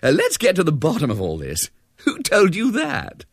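put-on high-pitched, amused young officer; amused demand. A narrator's reading. Major questions Ethel about the rumour, amused. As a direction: demand an answer, tickled by it all. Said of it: "said the major with another laugh".

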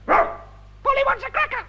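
squawking parrot, very high and loud. Acted.

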